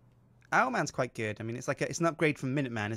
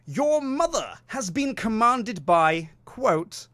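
slow, grand stage reading with high swoops; epic Shakespearean read; 6 semitones higher, 4 dB louder, much slower than usual. Pretend. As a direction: read the sign like a Shakespeare actor. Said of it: "I want Patrick Stewart performing Shakespeare".

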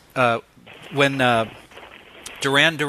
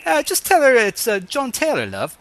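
hammed-up British accent, higher and louder; fake British accent; 8 semitones higher, 3 dB louder than usual. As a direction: pose as a British pop star on the phone. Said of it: "I do a great British accent".

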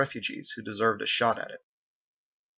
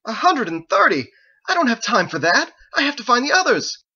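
loud, high outraged protest; frantic man out of patience; exasperated shout; 13 semitones higher, 8 dB louder than usual. Acted. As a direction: rage at the absurd demand. Ezekiel outraged at demand.

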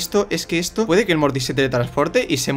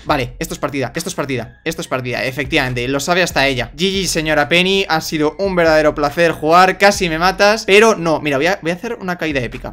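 usual speaking voice

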